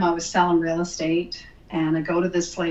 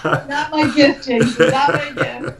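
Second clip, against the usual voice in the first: high, laughing disclaimer; laughing protest; 8 semitones higher, 4 dB louder than usual. Real, not acted. Guest tells host singing is beyond them, laughing high. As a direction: protest through real laughter, pitched up.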